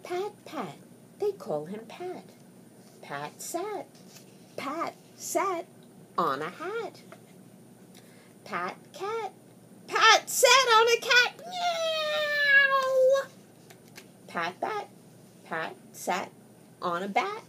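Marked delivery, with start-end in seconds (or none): 0.0-9.3 hushed. Acted. Hushed reading to child. 9.9-13.2 yowling cat. Acted. Cat cries out, cry heard.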